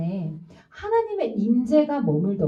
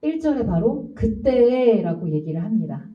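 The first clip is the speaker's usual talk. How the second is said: normal talking voice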